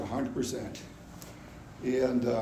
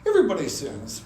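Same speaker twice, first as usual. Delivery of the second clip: ordinary talk, nothing marked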